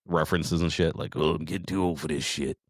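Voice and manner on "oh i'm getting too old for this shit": a hint of Murtaugh's tired grumble; mild movie-cop impression, slightly hushed; Lethal Weapon quote. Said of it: "we make Lethal Weapon, references".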